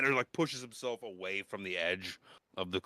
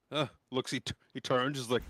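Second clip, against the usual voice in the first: usual speaking voice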